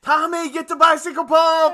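high, loud, laughing call; panicked mock shout. Doll deflating, shouting at Tommy for the pump. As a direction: yell across the house for help.

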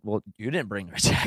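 low, muttered inner comeback; silent retort. Retold or imitated. Husband's unspoken reply to his wife, quiet and low. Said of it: "I'm thinking".

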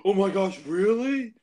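high, loud mock astonishment; gullible dummy. Imagining the party learning he isn't British, dumbfounded dummy voice. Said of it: "one dummy in the group's like".

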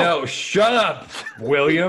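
high, drawn-out shout back; mock angry yell. Painter silencing the nosy neighbour, loud annoyed yell.

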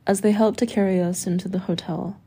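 usual speaking voice

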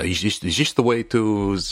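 put-on a failed try at a Dutch accent; half-hearted Dutch accent, quickly given up; Dutch accent attempt. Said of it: "I can't do the accent".

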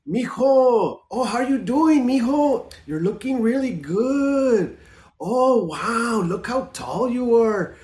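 put-on high, drawn-out, singsong Chicano accent; Chicano family accent. Relative greeting mijo at the family barbecue, unique Chicano accent. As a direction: gush over him in a drawling Chicano accent. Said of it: "they have a really, really unique accent".

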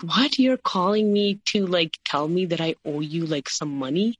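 indignant quoted "you can't be serious"; incredulous. Retold or imitated. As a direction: replay being stunned by an outrageous request. Retold disbelief at money demand.

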